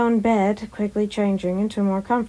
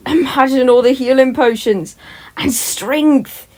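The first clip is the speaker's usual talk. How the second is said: excited; 4 semitones higher, 5 dB louder than usual. Acted. Tommy excited about new quirk.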